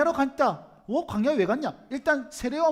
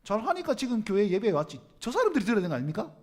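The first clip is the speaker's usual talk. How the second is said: ordinary talk, nothing marked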